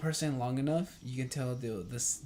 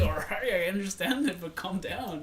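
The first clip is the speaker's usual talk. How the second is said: higher and louder, half-amused pushback; laughing it off; 7 semitones higher, 4 dB louder than usual. Retold or imitated. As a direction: wave him down, still amused. Retold reply after laughing.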